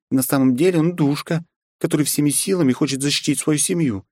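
character voice. Acted. Character voice in story.

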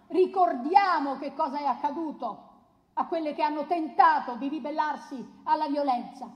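ordinary talk, nothing marked

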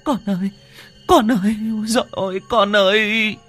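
high, loud crying lament; wailing mother. Acted. Mother wails over her seizing son, high and tearful. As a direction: cry out for your child. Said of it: "Cô Hải khóc giống lên".

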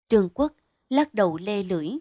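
ordinary delivery, nothing marked

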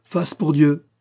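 mild high-pitched call; crying out. A narrator's reading. Drunken guest tossing a coin, calling out mildly. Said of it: "en criant".

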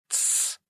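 unvoiced shushing hiss; sharp hush. A narrator's reading. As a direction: shush him hard. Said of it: "шикнул Костя".

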